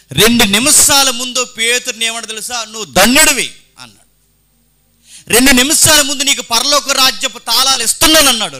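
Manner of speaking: loud, high preaching cry; fiery preaching. Acted. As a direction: preach it out to the hall at full force. Preaching at full force. (సభకు బిగ్గరగా ఉద్వేగభరితమైన ప్రసంగం)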